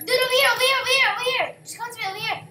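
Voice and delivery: high, loud call to a teammate. Real, not acted. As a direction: shout to get them over. Calling teammate to him.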